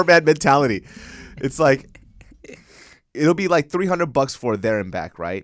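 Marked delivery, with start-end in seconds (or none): none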